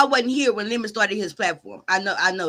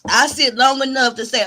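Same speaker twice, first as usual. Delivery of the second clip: normal talking voice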